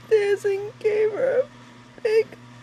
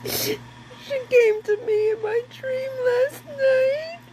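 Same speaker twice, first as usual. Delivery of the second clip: tearful and trembling, pushing words through sobs; slow, higher, choked with tears; crying; 3 semitones higher than usual. Real, not acted. Grieving mother telling of her daughter's visit, crying.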